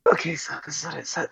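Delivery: reluctant, hushed whisper; very soft, bashful confiding. Retold or imitated. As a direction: whisper it like a shameful secret. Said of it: "Like, I will whisper it".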